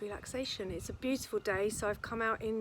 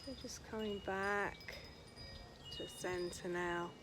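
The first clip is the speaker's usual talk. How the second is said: guided relaxation; 5 dB quieter than usual. Acted. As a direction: stretch the words out to pace their breathing. Leads guided breathing and stretches.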